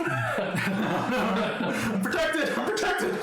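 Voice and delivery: high, laughing cry of relief; excited. Acted. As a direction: squeal it out, laughing. Player cries out in jest.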